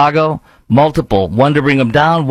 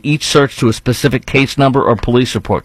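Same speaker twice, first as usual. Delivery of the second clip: ordinary talk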